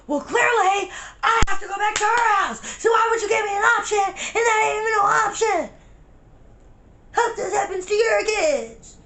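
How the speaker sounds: furious tearful outburst at the cop; upset crying rant. Retold or imitated. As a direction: yell it at him, voice breaking. Angry outburst at the officer.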